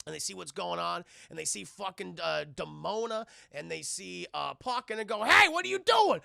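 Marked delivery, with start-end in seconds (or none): 5.2-6.2 high, loud mock challenge; confronting shout. Gargoyles catching Demona and Puck, shouted high. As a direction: call it out in alarm, high and loud.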